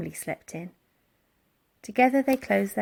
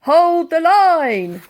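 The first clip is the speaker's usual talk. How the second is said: higher, louder, slow command; barked order; 8 semitones higher, 10 dB louder, much slower than usual. A narrator's reading. Barker ordering his men, shouted order. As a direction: hold the men firm.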